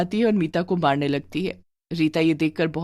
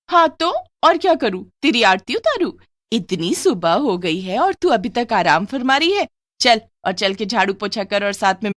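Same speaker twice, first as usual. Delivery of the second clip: harsh scolding; 3 semitones higher, 3 dB louder than usual. Acted. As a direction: bark orders at the lazy niece. Harsh aunt scolding niece.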